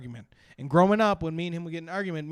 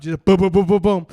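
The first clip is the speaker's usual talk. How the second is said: punchy imitated booms, much louder; 3 semitones higher, 11 dB louder than usual. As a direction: fire off each boom like a shot. Imitating eggs shooting out.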